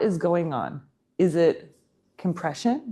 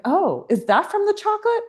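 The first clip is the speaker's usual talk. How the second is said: much higher, a hammed-up clueless guy; mock airhead; 13 semitones higher than usual. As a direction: ham up a dim, innocent question. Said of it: "he was like".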